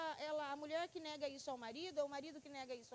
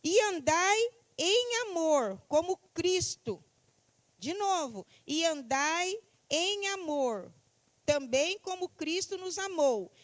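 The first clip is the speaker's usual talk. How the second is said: loud, slow proclamation of scripture; declaimed Bible verse, drawn-out words; scripture reading; 11 dB louder, slower than usual. Acted. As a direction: read the verse out slowly, projected to the congregation.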